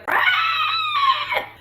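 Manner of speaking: piercing high mock shriek; hammed-up creature scream; monster shriek. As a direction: shriek like a stabbed sea monster. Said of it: "she's like".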